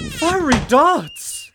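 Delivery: startled cry at the arrows; alarmed cry. Acted. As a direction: stretch the words out in shock.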